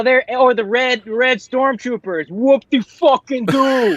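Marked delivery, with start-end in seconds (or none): 2.3-4.0 slow, high mock celebration; sarcastic. Real, not acted. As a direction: drag it out with sarcastic fake excitement. Mocking the new red stormtroopers.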